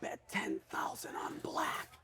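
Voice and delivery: very slow, drawn-out whisper; voice in his head. Acted. Inner voice urges the gambler to bet, whispered. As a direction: whisper each word slowly, mysteriously. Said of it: "The voice comes in his head".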